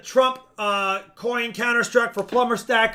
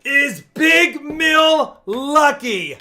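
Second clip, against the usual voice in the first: excited announcing; 5 semitones higher, 5 dB louder, slower than usual. Real, not acted.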